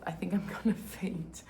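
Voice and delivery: breathy half-whisper. Real, not acted. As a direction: whisper it, faint from the heat. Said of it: "super hot".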